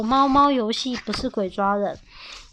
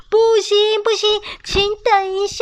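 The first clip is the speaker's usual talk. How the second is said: high, loud alarmed cry; urgent shout; 9 semitones higher, 4 dB louder than usual. Acted. Mom rushing to stop the chicks, high urgent cry. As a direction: call out to stop them in a panic.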